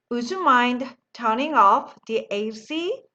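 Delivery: very slow, every word laid out; slow model sentence. Shown as a demonstration. Teacher modelling the phrase slowly for the class. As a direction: spell it out slowly for learners to copy. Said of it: "다 같이 한번 읽어볼까요".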